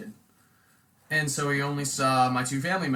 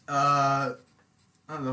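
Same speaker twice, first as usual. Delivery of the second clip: usual speaking voice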